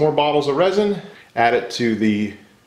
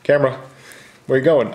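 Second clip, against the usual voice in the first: ordinary talk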